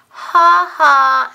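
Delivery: loud mocking little-girl laugh. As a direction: laugh at his mess. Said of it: "Anna laughed".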